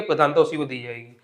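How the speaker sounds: ordinary delivery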